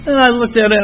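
ordinary delivery

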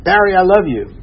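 retold mother's loud declaration; eager to be first. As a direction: jump in first with affection. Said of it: "trying to beat me to it".

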